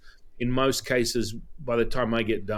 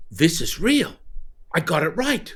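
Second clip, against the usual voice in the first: high, thrilled declaration; excited; 6 semitones higher than usual. Retold or imitated. Student telling the teacher his reading was right, high and thrilled. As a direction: announce your win with glee.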